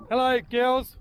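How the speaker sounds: calling chickens. Real, not acted. Greeting hens in the yard.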